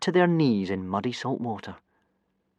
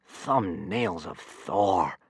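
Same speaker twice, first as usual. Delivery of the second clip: slow, softer inner exclamation; slow, private dismay; Stoic thinking; 3 semitones lower, 6 dB quieter, much slower than usual. A narrator's reading. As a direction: mutter the oath slowly to yourself. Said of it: "he was thinking".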